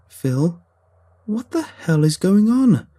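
high, loud, drawn-out frightened wail. A narrator's reading. Narrator wailing at Phil over the strange dogs. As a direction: wail it out in panic, slowly. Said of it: "I wailed".

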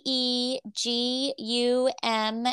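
letters spelled out slowly one by one; spelling it out. Shown as a demonstration. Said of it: "I would like to spell for your email address".

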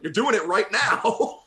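high, loud, drawn-out retort; friend calling it out. Retold or imitated. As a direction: imitate a friend crowing with laughter. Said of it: "She's like".

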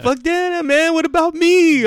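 very high, loud protest; mock indignation. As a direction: squeal it like a jealous complaint. Retold jealous comic protest.

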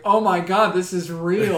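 overwhelmed pilot; thrilled exclamation. Retold or imitated. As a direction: gasp it out in disbelief. Retelling thrill while flying.

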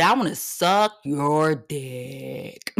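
low, slow, cracking joke line; joking drawl. Real, not acted. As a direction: drag it out low and laughing. Joking about the inventor.

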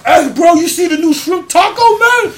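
loud, high, excited pitch; hyped friend. Retold or imitated. Drunk friends hyping the new Taco Bell shrimp taco, loud and high. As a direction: sell the shrimp taco to the crew. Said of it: "And they're like".

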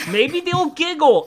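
high playful. Pretend.

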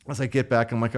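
usual speaking voice

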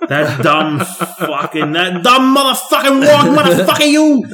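drawn-out, high-pitched cursing tirade; mock angry rant. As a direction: build from slow groan to a high yell. Mimicking an actor's rant.

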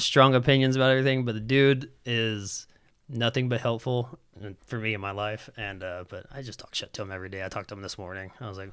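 ordinary delivery

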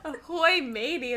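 high, loud pirate voice. Retold or imitated. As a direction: echo it back as a pirate. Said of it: "That sounds like a pirate".

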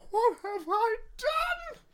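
high, slow anguished wail; screaming to the heavens. A narrator's reading. Elsa on the beach after freezing everyone, wailing in despair. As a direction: cry it up at the sky in despair. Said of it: "just screaming to the heavens".

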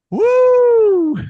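loud, high drawn-out "woo"; big, high-pitched whoop; long high whoop. Real, not acted. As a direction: let out a sustained high whoo. Letting off a long-Monday whoop.

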